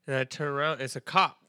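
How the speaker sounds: ordinary talk, nothing marked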